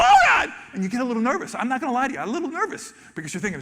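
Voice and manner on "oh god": much higher, louder gasp of "Oh, God!"; replayed shock at the whale; startled cry. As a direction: react as a huge tail swings overhead. Tail comes over the boat.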